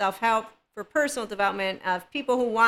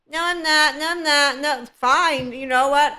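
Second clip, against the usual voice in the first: louder, higher repeated protest; mock argument; 4 semitones higher, 4 dB louder than usual. Acting someone arguing they do not suck, mock-defensive. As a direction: argue back in mock indignation. Acts out a back-and-forth quarrel.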